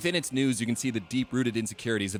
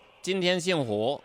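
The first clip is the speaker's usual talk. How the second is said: louder, higher southern Chinese accent; 5 semitones higher, 4 dB louder than usual. Shown as a demonstration. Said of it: "a southern accent saying".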